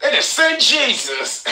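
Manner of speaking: high, stunned exclamation, slowing at the end; amazed disbelief. Retold or imitated. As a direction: marvel like he's seen a miracle. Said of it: "I said".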